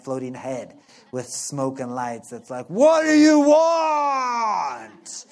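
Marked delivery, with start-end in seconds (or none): 2.7-5.2 huge, slow, much higher and louder shouted demand; booming Wizard of Oz. Retold or imitated. The Wizard of Oz booming down at a petitioner, loud slow shout. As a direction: boom it out like a giant floating head. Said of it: "he's kinda yelling at me".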